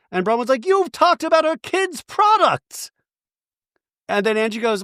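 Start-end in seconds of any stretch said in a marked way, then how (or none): 0.6-2.9 very high, drawn-out mock outrage; hammed-up high-pitched complaint; mocking imitation. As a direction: wail it slowly, way up high. Imitating Bronwyn in quarrel.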